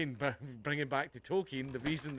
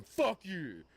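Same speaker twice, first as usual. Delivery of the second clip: higher mock rage at a passer-by; mock angry fan; 8 semitones higher than usual. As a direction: lampoon a seething fan yelling at strangers. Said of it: "walking down the street past random women going".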